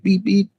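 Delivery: short high double beep; old-school alarm beep. Retold or imitated. Streamer reminding viewers of old alarms, imitated beep. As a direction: imitate a car alarm chirp. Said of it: "the old school alarms? They were like".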